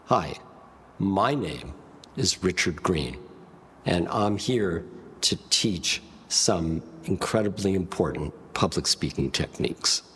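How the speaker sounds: low, resonant voice with measured pauses; deep rich resonance. Shown as a demonstration. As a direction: drop low and let each phrase resonate. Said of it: "and a deep, rich resonance".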